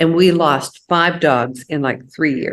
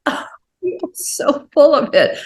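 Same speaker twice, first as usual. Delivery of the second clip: high, mocking scoff; teasing disbelief; 10 semitones higher than usual. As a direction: tease him playfully. Teases husband about no more dogs.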